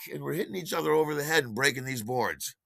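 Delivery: ordinary talk, nothing marked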